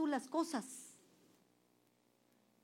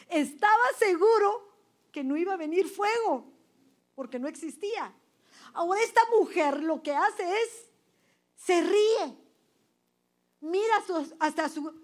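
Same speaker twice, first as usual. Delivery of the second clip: fervent preaching cry; 6 semitones higher, 11 dB louder than usual. Real, not acted. Preacher rising to full force.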